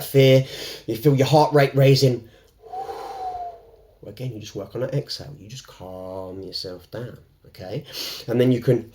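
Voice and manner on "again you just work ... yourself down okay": calm and soft. Shown as a demonstration. Coach talking viewers through fear, soft and soothing. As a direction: ease them down gently and quietly.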